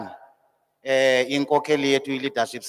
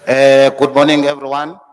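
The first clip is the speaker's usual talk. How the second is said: loud call to the room; loud greeting; 11 dB louder than usual. Real, not acted. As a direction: call out to the whole hall. Greeting the assembled hall.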